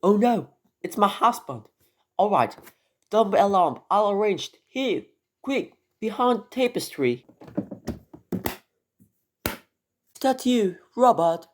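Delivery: a high, hurried lady hiding her visitor; alarmed lady. Acted. Wife hiding a man from her husband, high and alarmed.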